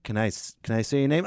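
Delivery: usual speaking voice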